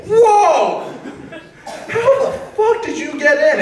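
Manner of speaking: stunned and laughing at once; shocked disbelief. Retold or imitated. Reacting to the friend's text from inside, laughing shock.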